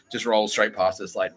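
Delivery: usual speaking voice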